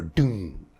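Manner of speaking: low resonant "doom" like a plucked upright bass; deep bass-string "doom"; vocal bass pluck. Shown as a demonstration. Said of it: "the sound that emanates from that vocally is".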